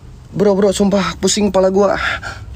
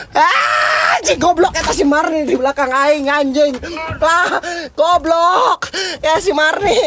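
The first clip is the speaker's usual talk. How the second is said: panicked shout; 6 semitones higher than usual. Real, not acted. Sees Marni behind him, panics.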